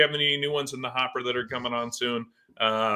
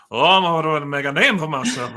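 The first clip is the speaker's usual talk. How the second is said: bad Cajun accent; 3 semitones higher, 5 dB louder than usual. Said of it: "That's Cajun? Oh, that's brutal".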